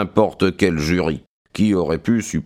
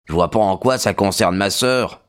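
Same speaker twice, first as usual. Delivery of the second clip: ordinary delivery, nothing marked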